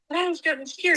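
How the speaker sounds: ordinary talk, nothing marked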